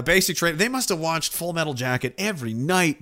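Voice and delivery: ordinary talk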